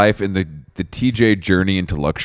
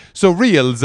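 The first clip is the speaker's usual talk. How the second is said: lightly acted high-pitched explainer; explaining to construction workers; 9 semitones higher than usual. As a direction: pitch it up, introducing reels to workmen. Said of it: "describing reels first to the construction workers".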